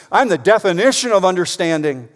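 God speaking. Retold or imitated. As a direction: declare it with divine certainty. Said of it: "He means".

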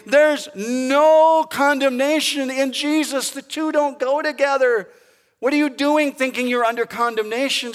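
high, loud proclamation with a stretched "no"; emphatic preaching. Real, not acted. Pastor telling believers there's no condemnation, impassioned shout. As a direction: drive it home at full voice. Preacher echoes the coach's rant.

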